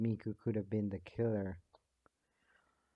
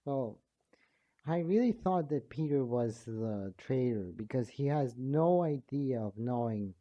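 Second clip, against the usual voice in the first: usual speaking voice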